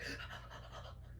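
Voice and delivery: breathy acted gasp of shock; voiceless, drawn-out gasp. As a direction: gasp as if stunned by news. She reacts to the mate news.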